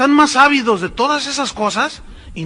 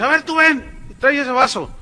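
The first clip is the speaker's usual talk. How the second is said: higher mock showman calling someone up; a stage preacher summoning a helper; showy preacher; 7 semitones higher than usual. Showman calls a volunteer. (predicador llamando a un voluntario, agudo)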